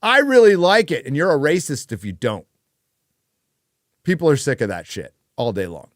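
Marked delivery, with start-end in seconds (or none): 0.0-2.5 high, loud sneering shill impression; mocking shill voice. Mocking shill critic sneers at anyone who disagrees. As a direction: jeer it like a smug paid shill, pitched way up. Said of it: "They just go".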